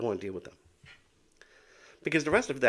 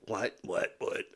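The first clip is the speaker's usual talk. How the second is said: imitating herself asking what; slower than usual. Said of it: "I'm still going, "What?".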